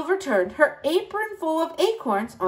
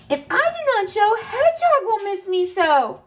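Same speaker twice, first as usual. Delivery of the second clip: high bunny voice; 8 semitones higher than usual. Acted.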